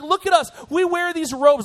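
high, loud show-off whine; whining religious leaders. Acted. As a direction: demand attention like a mock pompous whiner. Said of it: "They're whiners".

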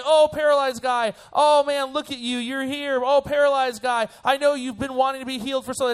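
high-pitched, hammed-up fussing over someone; mock gushing sympathy. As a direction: coo over him in exaggerated pity. Mock Jesus gushing over paralyzed man.

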